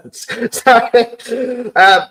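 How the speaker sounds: high, giggled apology; laughing apology. Real, not acted. Speaker cracks up at his own jiggling joke, laughing. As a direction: break up laughing as you apologize.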